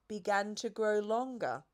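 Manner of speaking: usual speaking voice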